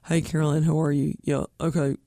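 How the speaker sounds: low-register presidential voice; Bill Clinton. Shown as a demonstration. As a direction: drop down low for a light president impression. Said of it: "get down to that register".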